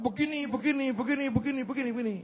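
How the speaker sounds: lightly mimicked treasurer, soft and rattled off; a hint of a fussy official explaining rules; mocking the treasurer. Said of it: "Dia tahu aturan". (sedikit meniru bendahara yang cerewet)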